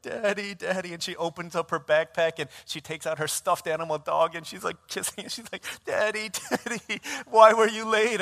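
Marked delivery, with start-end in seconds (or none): 0.0-0.9 imitated tearful little girl, higher pitch; mock sobbing for daddy; crying child. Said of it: "She starts crying, crying". 5.9-8.2 high-pitched imitation of a bawling girl; mock tearful reproach, building louder; crying child. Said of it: "She starts crying, crying".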